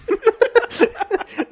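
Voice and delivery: bursting out laughing, high and loud; real laugh at a Bible verse; high burst of laughter. Real, not acted.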